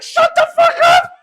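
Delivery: very high, loud shout; retold furious yell. Hushing the screaming kid, frantic mock shout. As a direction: yell in alarm to silence him.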